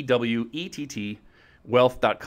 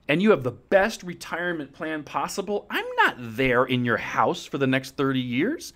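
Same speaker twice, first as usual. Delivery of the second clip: ordinary talk, nothing marked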